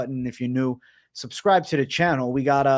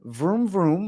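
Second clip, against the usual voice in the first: engine vroom; 3 semitones higher than usual. Pretend. The car engine is moving.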